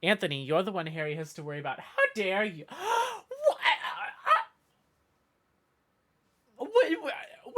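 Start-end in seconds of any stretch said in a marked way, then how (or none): 1.8-7.6 gasping, much higher hammed-up shock; mock outrage. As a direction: play the wounded diva, gasp and stammer. Mock offense at chat teasing.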